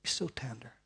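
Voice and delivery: soft whisper; tender whisper. Real, not acted. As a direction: breathe it tenderly.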